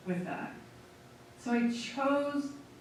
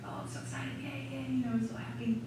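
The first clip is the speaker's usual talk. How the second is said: mock excited dog greeting; 2 semitones higher, 6 dB quieter than usual. Coming home, cooing softly at Angela's dog with mock delight. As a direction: gush over the little dog like a baby.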